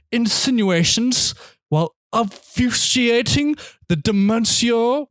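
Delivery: snobby critic. Acted. Podcaster reading a one-and-a-half-star review aloud, snobby critic voice. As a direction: read it like a haughty, self-important reviewer. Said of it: "get into my snobby critic voice".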